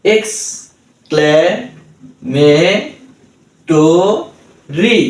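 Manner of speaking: Exclamatory stretched very slowly, rising at the end; very slow drawn word. Shown as a demonstration. Tutor repeats the word for learners, very slowly. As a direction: hold exclamatory long and let it rise. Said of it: "তাহলে কি হলো?".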